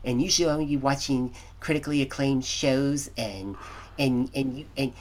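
mock-stuffy critic looking down on trash TV. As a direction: play a self-important, distinguished critic. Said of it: "trying to get a good stuffy voice".

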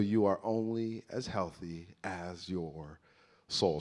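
deliberate soft restatement; slow emphasis. Shown as a demonstration. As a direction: lay it down slowly and softly. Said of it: "But listen, here's the deal".

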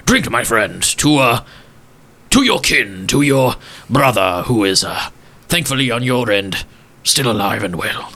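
lightly voiced old gent, hushed in places; a hint of a sorrowful old gentleman remembering; wistful old gentleman. Walsworth toasts.

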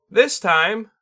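high, loud bird spirit sensei; a lofty bird teacher, amused; Tengu voice. Acted. Tengu adds instruction.

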